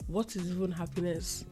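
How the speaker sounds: ordinary talk, nothing marked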